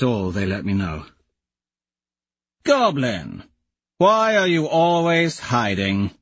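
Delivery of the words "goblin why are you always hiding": higher, slow teasing call; mocking call. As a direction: tease him out of hiding. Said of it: "Tactus calls".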